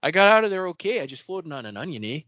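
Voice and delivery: put-on a light Canadian country accent. Yalb joking about his escape, in a light Canadian accent. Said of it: "real country, but, like, Canadian".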